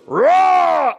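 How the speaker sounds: put-on a girl pouncing with a roar; mock crocodile roar. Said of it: "and she goes".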